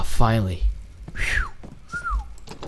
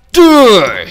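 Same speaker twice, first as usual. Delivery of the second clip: high, loud falling yelp; sudden exclamation; 8 semitones higher, 7 dB louder than usual. Real, not acted. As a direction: blurt it out loudly. Game startles him, loud.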